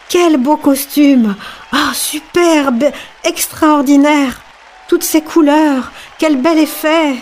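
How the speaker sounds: high, drawn-out cries of admiration; admiring exclamations. A narrator's reading. Crowd marvels at the emperor passing, exclaiming in admiration. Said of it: "et chacun s'exclama".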